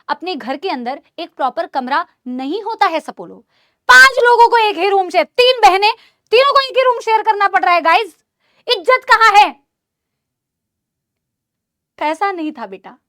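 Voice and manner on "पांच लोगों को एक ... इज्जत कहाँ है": mock indignant outburst. Dramatizes sharing one room.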